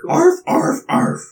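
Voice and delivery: high yappy little dog voice; yappy chihuahua voice. Acted. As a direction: yap it out like a tiny dog. GM voicing a dog character.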